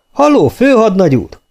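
high, carrying hail to an officer; breezy shout for attention; calling out. A narrator's reading. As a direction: call across the yard, pitch way up. Recruit hails passing officer.

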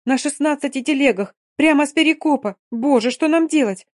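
high, loud alarmed cries; panicked. Acted. Dina panicking to the doctor about the wounded. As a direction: cry out in rising alarm.

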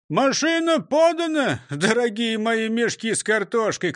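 high-pitched shouted announcement; calling out. Acted. Driver calls to robbers hidden in sacks, shouting high. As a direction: call it out high across the yard. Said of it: "крикнул Крендель".

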